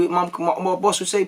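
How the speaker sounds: usual speaking voice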